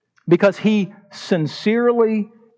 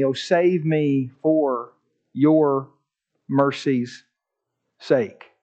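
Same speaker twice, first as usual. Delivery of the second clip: humble scripture reading; 6 semitones lower than usual. Acted. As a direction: read it like a broken man praying. Reads penitent psalm, low and soft.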